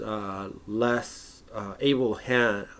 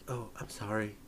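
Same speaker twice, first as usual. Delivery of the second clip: much softer, meek and polite; abrupt switch to soft politeness; sudden calm apology; 9 dB quieter than usual. Retold or imitated. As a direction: drop instantly to a gentle apology. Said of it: "from a hundred to, you know, zero".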